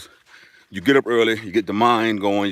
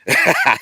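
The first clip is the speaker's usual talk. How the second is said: high, louder burst of laughter; amused laugh; 5 dB louder than usual. Real, not acted. Streamer laughing at viewer's verdict on accent, high burst.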